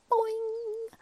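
high, loud comic crash; comic boing. A narrator's reading. Narrator telling children a crash, playful. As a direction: pop the crash word like a cartoon.

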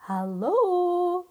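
loud, high call upward; shouted hello. Acted. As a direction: call up to a giant far above. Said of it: "he shouted so the giant could hear".

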